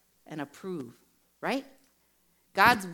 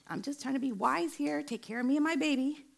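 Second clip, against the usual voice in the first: high-pitched self-justifying protest; mock defensive; 10 semitones higher than usual. New mother defending her fear to a friend, mock defensive. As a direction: plead your case in a high, put-on voice.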